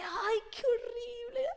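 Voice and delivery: high, slow fake crying whine; mock whining. Dismissing self-pity about debts, a high slow mock whine. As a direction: whimper it as a pretend complaint. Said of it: "no te voy a decir que".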